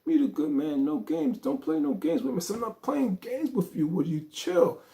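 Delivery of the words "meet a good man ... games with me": high, whiny mock cry of a tired woman; imitating her crying. Girlfriend complaining to him about men, mock crying. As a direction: play her teary plea, lightly put on. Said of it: "all crying".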